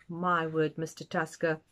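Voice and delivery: ordinary delivery, nothing marked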